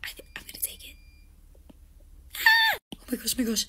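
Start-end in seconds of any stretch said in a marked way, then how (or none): 0.0-1.0 sneaky whisper. Acted. 2.4-2.8 shrill loud scream; startled scream. Acted. Caught girl screaming in fright.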